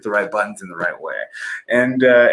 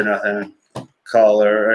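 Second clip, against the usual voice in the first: ordinary delivery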